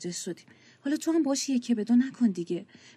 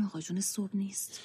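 whispering; 9 dB quieter than usual. Acted. Worried family whispering about grandpa.

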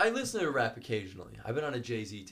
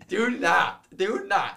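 protest; 8 semitones higher, 9 dB louder, much slower than usual. Real, not acted. Protesting gym classes idea.

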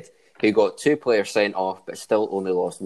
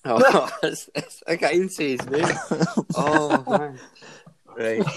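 laughing; 9 semitones higher than usual. Real, not acted. Can't get through the joke.

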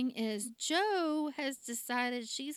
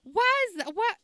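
higher and louder, lecturing a friend; mock scolding; 6 semitones higher, 5 dB louder than usual. Friends telling him off about his girlfriend, loud mock scolding. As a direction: scold him like a know-it-all buddy. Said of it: "They're like".

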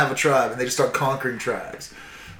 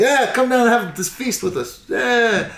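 much higher, sweet-talking host voice; fake friendly invite; 10 semitones higher than usual. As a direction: lure them in with a sugary high invite. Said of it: "They're like".

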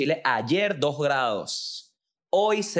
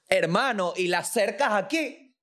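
much higher, strange voice of an indignant elephant; talking elephant; 11 semitones higher than usual. Acted. Elephant complaining to the scientists, high and indignant. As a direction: play the fed-up elephant, pitched way up. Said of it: "Dice el elefante".

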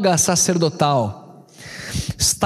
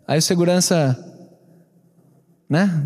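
ordinary talk

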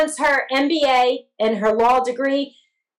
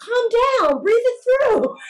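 put-on kids calming mom; 9 semitones higher than usual. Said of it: "And they're like".

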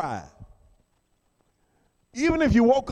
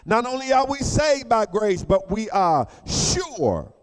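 louder, higher, shouted sermon climax; preaching at full force; 4 semitones higher, 3 dB louder than usual. Acted. Preacher challenging the congregation, fiery full-force preaching. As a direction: drive it home to the church.

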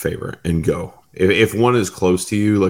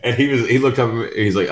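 usual speaking voice

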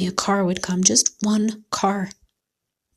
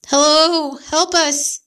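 calling for help; 7 semitones higher, slower than usual. A narrator's reading. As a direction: shout for anyone to hear. Calling out on empty highway.

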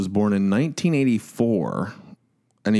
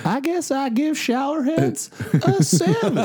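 put-on higher old-timer voice with a laugh; old prospector. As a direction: play a grizzled old prospector. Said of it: "Mine sounds like an old prospector".

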